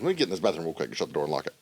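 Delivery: rattled off fast; fast rushed. Retold or imitated. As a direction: rush through it. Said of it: "I'm like".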